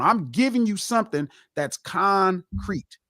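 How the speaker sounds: ordinary delivery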